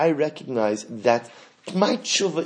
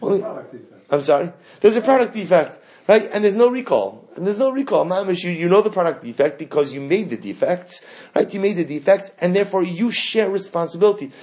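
louder, higher, outraged; indignant; 6 semitones higher, 5 dB louder than usual. Acted. Preacher arguing the worshipper's case to God, indignant. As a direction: argue the point with rising heat. Heated argument on God's part.

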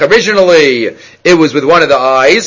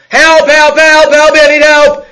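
high, loud cries of help; mock scream for help; 10 semitones higher, 5 dB louder, slower than usual. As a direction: yell for help in a panic at night. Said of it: "Rav Shach started screaming".

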